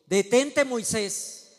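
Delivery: God shouting a warning; shouted command. Acted. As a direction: call out sharply to stop him. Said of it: "él le gritó".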